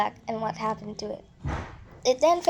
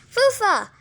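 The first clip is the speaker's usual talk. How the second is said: high, loud call of a name; 10 semitones higher, 6 dB louder than usual. Acted. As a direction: shout to get her attention. Tootie calls out to Fufa.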